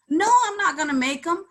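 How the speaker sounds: retold indignant no, high and loud; exasperated refusal. Answering people who ask her for masks, exasperated.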